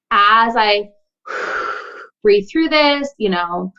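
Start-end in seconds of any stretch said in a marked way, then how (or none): 1.3-1.9 long audible breath out; demonstrative exhale. Shown as a demonstration. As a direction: breathe out slowly to let it go. Demonstrates breathing through the fear.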